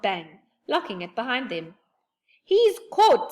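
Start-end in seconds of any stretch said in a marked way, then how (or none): 2.4-3.3 high, loud triumphant cry; joyful high shout. A narrator's reading. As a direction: crow with delight. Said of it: "said Moonface joyfully".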